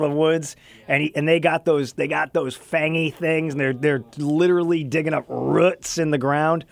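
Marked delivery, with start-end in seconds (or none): none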